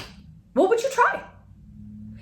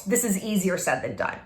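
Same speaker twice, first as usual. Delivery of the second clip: ordinary delivery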